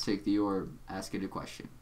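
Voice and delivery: low, measured, quiet villain voice; deep Doc Scratch voice. Acted. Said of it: "a really deep voice".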